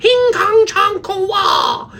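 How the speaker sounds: high, loud, drawn-out imitated shriek; screamed mock language. Coach imitating daughter's outburst in grocery store, screaming mock language. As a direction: burst out screaming pretend foreign words, mimicking. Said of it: "just out of nowhere goes".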